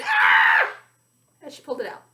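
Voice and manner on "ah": a sharp, much higher and louder yelp of shock; sudden scream. A narrator's reading. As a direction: scream as the cigarette turns into a worm. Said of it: "She put one in her mouth and then screamed".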